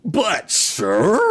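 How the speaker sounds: ordinary talk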